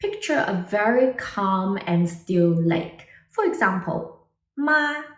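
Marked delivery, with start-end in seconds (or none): 4.6-5.0 high, level tone on "ma"; "ma" sustained on one high, even pitch; first tone. Shown as a demonstration. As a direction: hold "ma" high and steady like a still lake. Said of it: "typically high and leveled".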